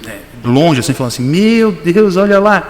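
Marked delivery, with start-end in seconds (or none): 1.2-2.6 voz zombeteira. Retold or imitated. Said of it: "uma outra voz que não era minha".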